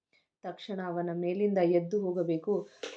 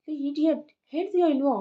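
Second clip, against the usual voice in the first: sharp scolding, higher and louder; 7 semitones higher, 6 dB louder, faster than usual. Acted. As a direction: snap at her to talk. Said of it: "ಗದರಿ". (ಗಂಡ ಹೆಂಡತಿಯನ್ನು ಗದರುವುದು, ಜೋರಾಗಿ)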